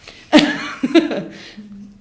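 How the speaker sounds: breathy high burst of laughter; real laugh at the memory. Real, not acted. Laugh after wanting to smack.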